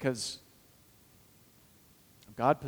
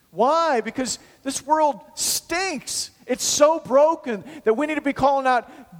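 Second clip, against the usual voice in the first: full-force preaching; 9 semitones higher, 6 dB louder than usual. Acted. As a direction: proclaim it with conviction.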